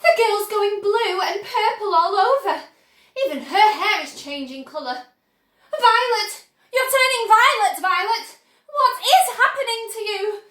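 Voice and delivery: high, loud frantic cry; panicked yelling. A narrator's reading. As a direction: wail at the daughter in horror. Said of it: "yelled Missus Beauregard".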